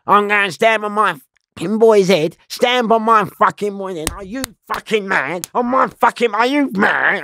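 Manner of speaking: high-pitched, heated taunting while fighting; re-enacted angry outburst, high and insistent; fight rant. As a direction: rant it like you're mid-punch, pitch up. Acting out his ranting during a fight.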